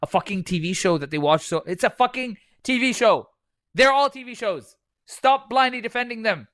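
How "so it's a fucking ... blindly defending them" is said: much higher, heated rant; fed-up raised rant; exasperated rant. Real, not acted. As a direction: vent at blind defenders. Ranting about defensive fans.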